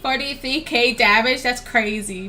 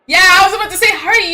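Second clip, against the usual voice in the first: excited; 7 semitones higher, 10 dB louder, faster than usual. Real, not acted. Reacting excitedly to a teammate choice.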